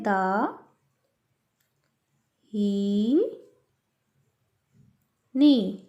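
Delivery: दाहिनी split into दा, ही, नी with long pauses; teacher showing how to write दाहिनी, syllable by syllable. Shown as a demonstration. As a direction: spell out दाहिनी slowly, one syllable at a time. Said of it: "तो दाहिनी कैसे लिखते हैं".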